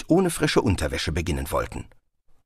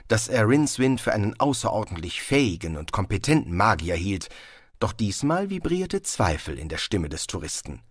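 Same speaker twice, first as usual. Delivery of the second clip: ordinary delivery, nothing marked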